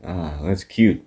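low, soft, deadpan put-down; a muted sneer; sarcastic dismissal. Retold or imitated. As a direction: brush him off like he's a child. Unimpressed wrestler mocks opponent.

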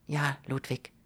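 very soft, fragile reply; whispered. A narrator's reading. Ruth answering Ludwig as he plans ahead, whispered. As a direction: breathe it out under your breath. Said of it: "flüsterte sie".

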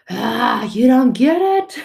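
hammed-up exasperation; exasperated outburst. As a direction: groan it out in frustration. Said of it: "And we're like".

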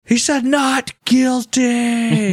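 high, slow, drawn-out announcement; mock courtroom proclamation, high and slow; judge yelling out. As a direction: call it out from the balcony to the crowd. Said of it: "Judge fucking yells it out".

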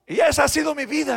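builds to a loud, high cry; soaring pulpit climax; rising preaching. Acted. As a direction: lift the congregation with it.

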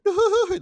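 high, giggly imitated laugh; Arnold's laugh. Opening the hotel door, imitating the friend's giggle. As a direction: mimic his friend's little laugh. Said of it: "he does his little, like, Arnold laugh".

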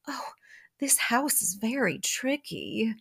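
slow, badly faked Southern drawl; hammed-up Southern belle drawl; terrible Southern drawl. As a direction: draw it out in a mock Southern accent. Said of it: "in her southern drawl".

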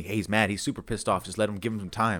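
low, soft retold caution; subdued acted-out warning; hushed advice. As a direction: advise quietly, keeping it low. Friends' advice retold quietly.

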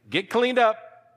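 high, slow, emphatic command; calling out. Acted. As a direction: pitch it up and stretch it out as a summons.

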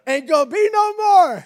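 high, loud mock declaration; loud mock refusal. Adam refusing further babies, loud mock refusal. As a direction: shout it like Adam giving up. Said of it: "Adam would have gave birth".

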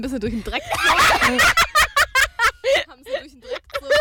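giggling through the words; fighting laughter; laughing while talking. Real, not acted.

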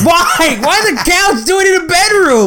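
high-pitched incredulous outburst; appalled protest. Real, not acted. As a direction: plead it way up high in disbelief. Friend appalled at the repeat offence.

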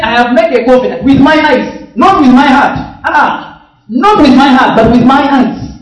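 loud, high, punched scripture quote; emphatic preaching. Real, not acted. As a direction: hammer each contrast home to the congregation. Drives home the verse.

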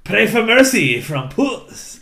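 put-on menacing cat swordsman with a Latin accent, drawn out. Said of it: "I kept trying to do a Latin accent".

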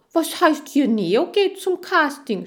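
high, loud indignant hamster; outraged grumbling at full voice; scolding. A narrator's reading. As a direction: object angrily, as if cheated. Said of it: "schimpfte Chan".

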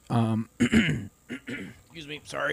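clearing the throat mid-sentence; choked up. Real, not acted. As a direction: apologize through a catch in your throat. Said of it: "you're all choked up about this".

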